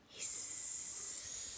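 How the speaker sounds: very slow, drawn-out whisper; whispered hiss. Acted. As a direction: stretch the hiss out secretly. Reading the snake's noise.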